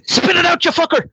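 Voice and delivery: high-pitched comic outburst; punchline yelled way up high; angry Irishman. Acted. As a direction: scold the fly like a furious Irishman. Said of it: "A fly goes in the Irishman's beer".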